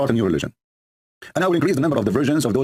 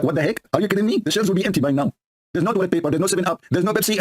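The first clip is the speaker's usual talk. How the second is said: high-pitched indignant protest; hammed-up incredulous complaint; mock arguing; 6 semitones higher than usual. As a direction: argue back in exaggerated, high-voiced exasperation. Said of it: "the Abdul is arguing with his God".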